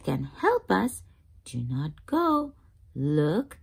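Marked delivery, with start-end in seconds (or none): none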